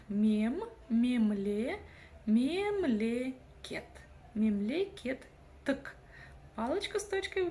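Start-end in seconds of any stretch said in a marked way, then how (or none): 0.1-6.0 "мем, мемле, мемлекет", drawn out with pauses; teacher's slow drill growing into "Мемлекеттік"; syllable build-up. Shown as a demonstration. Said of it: "нужно разделить по слогам".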